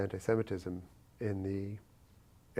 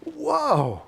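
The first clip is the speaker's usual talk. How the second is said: high stretched whoa, amazed; stunned whoa; 8 dB louder than usual. Retold or imitated. Recalling the shotgun moment, a high astonished whoa. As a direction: let the whoa hang in shock. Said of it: "I was sitting there going".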